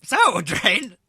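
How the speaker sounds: high, loud character voice; storyteller character inviting a tale. Pretend. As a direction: pitch it up and prompt them. Game character asks for story.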